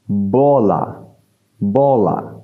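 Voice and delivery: bola with an open o; Portuguese bola. Shown as a demonstration. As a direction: model bola for learners. (bola, o ouvert)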